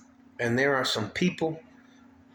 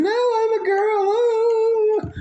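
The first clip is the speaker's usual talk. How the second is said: much higher, louder mock girl's squeal; mocking girly voice; 7 dB louder, much slower than usual. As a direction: ham up a shrill-high mocking woman. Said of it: "And all of a sudden".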